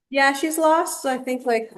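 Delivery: usual speaking voice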